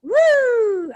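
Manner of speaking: loud high whoop; cheering. Real, not acted. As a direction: whoop for the speaker. Cheering the introduction.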